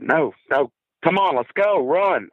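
high, hurried pleading; high-pitched retold panic; panicked urging. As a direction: get him moving now. Scared boy urging friend to flee.

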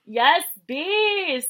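mock cheer. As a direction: cheer her on like Demi, high and loud. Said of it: "Demi goes".

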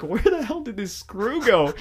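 high, loud, slowed frustrated outburst; getting pissed. Retold or imitated. Hunting the lost screw at night, loud frustrated outburst. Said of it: "I'm getting pissed".

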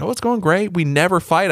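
cheerful wife. Retold or imitated. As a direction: imitate a blithely upbeat spouse. Voicing wife's cheerful answer to friends.

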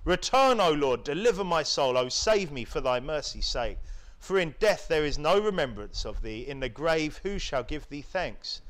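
solemn, measured Bible reading; scripture reading. Acted. Preacher reads a psalm aloud to his congregation, solemn and measured.

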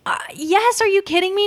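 mock incredulous. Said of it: "And it's like".

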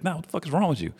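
high-pitched mock outrage without extra volume; indignant confrontation. Friend turning on his other buddy, indignant. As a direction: imitate a friend insisting on his complaint. Retold angry confrontation, repeated demand.

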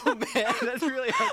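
laughing. Real, not acted. Laughing after coughing.

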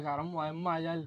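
very soft, under-the-breath retold thought; about to faint. Man retells wanting to warn friends he'd faint, very quietly. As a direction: drop to a faint murmur.

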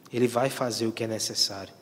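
usual speaking voice

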